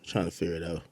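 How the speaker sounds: mumbled under the breath; quiet aside. Real, not acted. Streamer muttering to himself while blocking chat. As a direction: trail off to yourself. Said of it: "muttering low aside".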